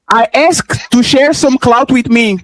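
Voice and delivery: slow, deliberate mock quoting; drawn-out recital of someone's message; mocking readout. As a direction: read the message out slowly to ridicule it. Reads guest's message aloud to ridicule it.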